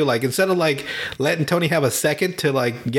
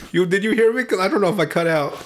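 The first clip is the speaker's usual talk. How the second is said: usual speaking voice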